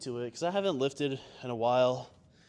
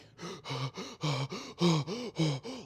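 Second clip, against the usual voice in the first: sharp, high panicked gasp for air; a reenacted hyperventilating gasp; 6 semitones higher than usual. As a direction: gasp like you can't catch your breath. Panic attack after hitting car.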